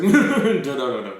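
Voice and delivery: a loud, laughing no that drops off fast; mock refusal, half laughing. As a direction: brush the praise off with a laugh. Laughing refusal of fans' praise.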